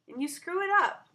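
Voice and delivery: high, loud jab; taunting. Acted. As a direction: rub it in. Child repeating harsh verdict.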